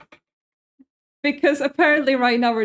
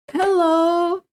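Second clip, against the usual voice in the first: gasping hello; 6 semitones higher, 3 dB louder than usual. Real, not acted. Gasps at a new viewer.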